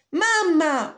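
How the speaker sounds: high, loud yell for mother; Jack yelling. Acted. Jack landing and calling his mother, urgent high yell. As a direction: shout down to the house in a panic. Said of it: "urlò Jack".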